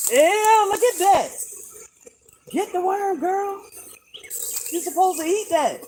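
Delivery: high, sing-song coaxing voice for a chicken; pet voice. Acted. Owner coaxing her chicken to eat the worm, high cooing voice. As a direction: coo at the hen like a baby.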